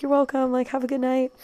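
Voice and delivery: high, softened polite sign-off; sweetly retold farewell; sweet goodbye. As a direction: act out a starstruck fan's goodbye. Acts out sweet goodbye to family.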